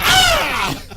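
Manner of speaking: high, loud shocked scream; mock scream. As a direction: shriek in mock horror. Everyone screams.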